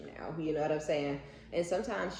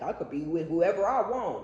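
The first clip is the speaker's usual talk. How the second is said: louder, puffed-up mock brag; mock cocky boast; 3 semitones higher, 6 dB louder than usual. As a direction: puff it up like the vain ex. Mocking the ex's ego.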